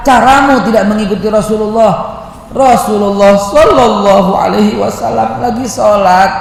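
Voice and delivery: loud, high, impassioned sermon; fiery preaching. Acted. Preacher rebuking modern parents, loud and impassioned. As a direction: preach it with rising fervor.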